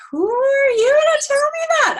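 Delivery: high, loud mock outrage; indignant retort. Retelling her comeback to the orthopedic surgeon, indignant. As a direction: exclaim it high in affronted disbelief.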